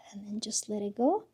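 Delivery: soft whispered release; whispering. Shown as a demonstration. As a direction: breathe the words out in a whisper. Guiding the exhale.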